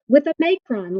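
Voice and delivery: ordinary delivery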